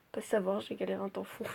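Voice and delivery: galéré muttered very softly, almost under the breath; quiet grumble. Real, not acted. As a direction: drop the voice right down on galéré. Same complaint, quiet then loud. (galéré dit tout bas, presque dans un souffle)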